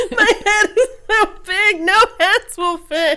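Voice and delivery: high, laughing mock lament; mock whiny man. Friends mocking the crying hat man, whiny and high. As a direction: whine like the big-headed man.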